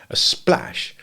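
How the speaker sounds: plain reading voice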